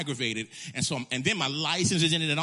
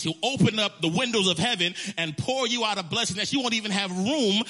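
full-force preaching; 6 semitones higher, 5 dB louder than usual. Acted. Preacher quoting Philippians to the church, fervent. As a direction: preach it with swelling fervor.

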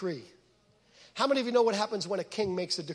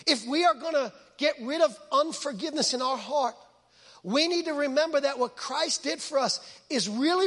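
usual speaking voice

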